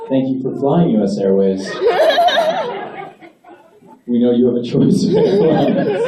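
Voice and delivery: smooth flight attendant announcement; airline announcement. Pretend. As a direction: read it like cabin PA.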